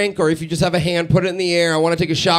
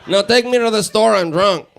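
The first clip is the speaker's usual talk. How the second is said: put-on unromantic Spanish-speaker accent. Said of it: "That's what it sounds like, ladies".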